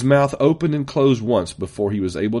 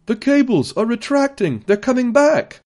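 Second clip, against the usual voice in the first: very high-pitched shout of news; high, excited call; excited cry; 14 semitones higher, 4 dB louder than usual. A narrator's reading. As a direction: cry it out across the room, high and urgent. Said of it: "a cry came from several of the technicians".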